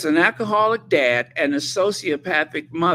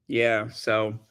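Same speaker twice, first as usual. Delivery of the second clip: ordinary talk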